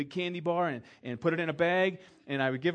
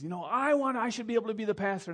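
mock protesting woman; 8 semitones higher, 4 dB louder than usual. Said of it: "is kind of bucking and says".

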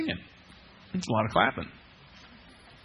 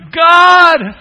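shouted, much higher cry of God!; calling God; 12 semitones higher, 16 dB louder than usual. Retold or imitated. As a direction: call out for help at full voice. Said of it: "and you go".

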